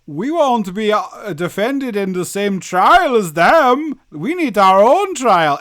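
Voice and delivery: slow, loud mock-Brazilian accent; Brazilian character voice. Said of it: "Make the Brazilians be like".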